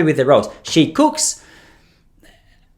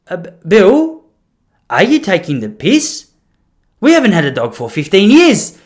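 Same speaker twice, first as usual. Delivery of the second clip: raised, incredulous mock grandma; exasperated grandmother; 6 semitones higher, 6 dB louder than usual. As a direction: impersonate a wife who's had enough. Voicing exasperated grandmother answering.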